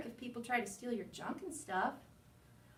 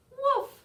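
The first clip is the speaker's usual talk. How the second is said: shrill excited woof; high loud bark; 13 semitones higher, 11 dB louder than usual. Acted. Dog barks in story.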